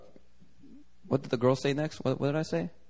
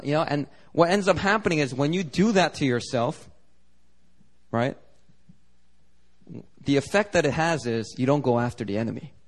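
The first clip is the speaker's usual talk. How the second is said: usual speaking voice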